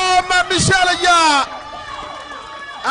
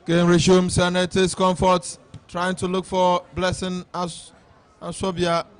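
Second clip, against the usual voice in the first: steady match commentary; live play-by-play; 4 dB louder than usual. Real, not acted. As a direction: call the game as it happens.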